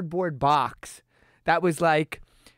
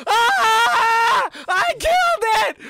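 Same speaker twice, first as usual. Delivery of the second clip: much higher, louder horrified cry; panicked shout; 13 semitones higher, 7 dB louder, much slower than usual. Retold or imitated. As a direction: yell in guilty panic. Friend panics over the severed tail.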